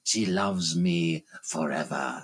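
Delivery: low, slow and mocking; sinister phantom voice. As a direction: gloat over his own ugliness. Phantom taunting Christine.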